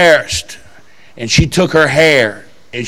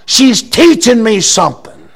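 preaching shout; 5 dB louder than usual. Retold or imitated. Preacher retelling his answer at full force. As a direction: lift it up and shout it. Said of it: "And I says".

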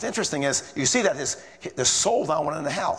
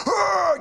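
high, loud outraged growl; a hammed-up explosion of rage; mock angry roar; 4 dB louder than usual. Professor exploding at the preacher, mock furious roar. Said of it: "he exploded".

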